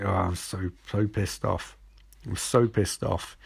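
lightly acted hungover voice, low and soft; hungover grumble. Caitlin comes in the next morning, lightly acted hungover grumble. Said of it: "She's really hungover".

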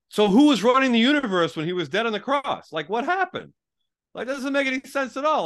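high, loud disbelieving protest; incredulous. Retold or imitated. Telling the Amway guy it makes no sense, incredulous. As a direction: push back, baffled and indignant. Arguing disbelief at born-again idea.

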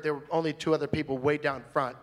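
normal talking voice

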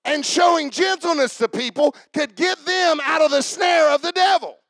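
full-force preaching. Real, not acted. Preacher on gentleness freeing people from the devil, forceful shout. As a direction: drive it home at full volume.

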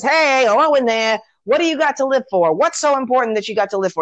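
high, quick calling-out voice; Miracle Max. Retold or imitated. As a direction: call in as if checking someone is home. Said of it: "and then he says".

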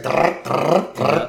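vocal rattling noise, repeated in bursts; rolled rattle sound effect; imitated cup rattling. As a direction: mimic a cup dragged along bars. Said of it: "Maggie rattling her cup".

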